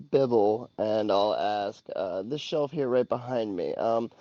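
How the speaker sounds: ordinary talk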